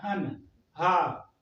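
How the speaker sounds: हन and हा said very slowly, then Sohan; slow syllable demo. Shown as a demonstration. Teacher building a name for students, slow and clear. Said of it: "syllable demo for students".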